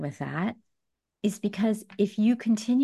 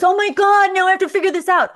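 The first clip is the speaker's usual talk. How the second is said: high, loud hammed-up panic, Oh my God; mock overwhelmed wail; 10 semitones higher, 9 dB louder, faster than usual. Mocking someone who treats figuring it out as a curse, hammed-up loud panic. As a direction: throw up your hands in fake despair.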